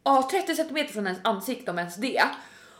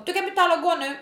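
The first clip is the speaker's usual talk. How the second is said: higher-pitched curt mock demand; rude, stressed; 8 semitones higher, 4 dB louder, faster than usual. Rude lady demanding to pay and go, mock stressed. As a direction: imitate an unpleasant, stressed stranger. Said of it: "De är så här otrevliga".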